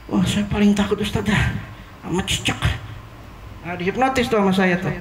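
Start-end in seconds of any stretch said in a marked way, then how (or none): 0.1-2.6 mock fearful. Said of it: "Takut kan fobia ya?".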